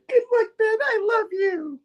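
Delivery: very high falsetto comic voice; mock tearful farewell in a squeaky falsetto; hammed-up high-pitched lovelorn goodbye; [name]. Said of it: "doing those horrible voices".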